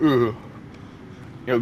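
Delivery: ordinary talk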